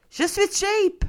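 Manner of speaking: very high put-on squeak; mock squeaky voice. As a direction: squeak the confession like a cartoon. Jokes about being cheap.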